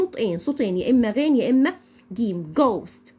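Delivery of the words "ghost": "ghost" with an Egyptian gim; GH as hard G. Shown as a demonstration. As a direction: pronounce "ghost" as "gost". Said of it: "نطقناها زي حرف الجيم بالظبط".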